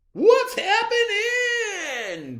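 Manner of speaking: booming high slow shout; blaring greeting. A narrator's reading. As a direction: blare it at full lungs. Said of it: "blared Tacky".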